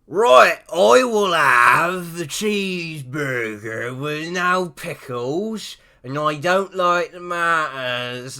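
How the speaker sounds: put-on slow, loud, broad country English accent ordering food; broad rural English accent. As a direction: drawl the order in a thick English village accent. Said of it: "that was definitely not English".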